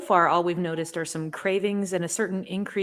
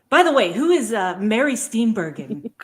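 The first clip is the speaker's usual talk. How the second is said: put-on high, loud, puzzled childlike voice; confused Ergo; 4 semitones higher, 8 dB louder than usual. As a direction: ask it with innocent confusion. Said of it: "He gets confused".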